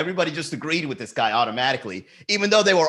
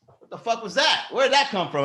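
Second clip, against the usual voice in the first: high-pitched mock bewilderment; startled double take; 8 semitones higher than usual. Host retelling a heckle from behind, mock startled. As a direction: act out being caught off guard. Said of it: "I had to do a double take".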